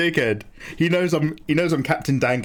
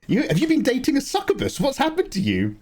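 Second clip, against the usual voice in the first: mock shocked viewer; 8 semitones higher than usual. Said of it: "they're just like".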